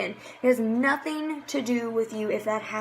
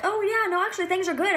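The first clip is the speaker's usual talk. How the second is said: higher, breezy brush-off; light mock chipper reply; 7 semitones higher than usual. A new teammate brushing off the question, lightly higher and chipper. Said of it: "if they're like".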